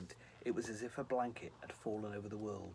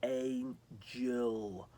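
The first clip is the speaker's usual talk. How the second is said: slow, drawn out; 4 dB louder than usual. Acted. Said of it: "slowly giving the word two syllables".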